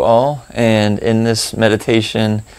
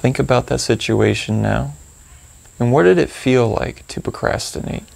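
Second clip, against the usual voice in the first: calm, spacious phrases with long pauses; gently prompt, leaving room to notice each feeling; guided meditation; 7 dB quieter than usual. Acted. Guide leading listeners to reflect on procrastination, calm meditation delivery.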